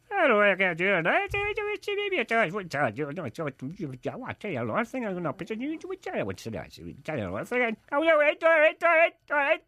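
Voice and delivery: put-on comic Derry accent on request. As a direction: rattle it off in a thick Derry accent. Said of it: "do the Derry accent, the one that is fast and hard to understand".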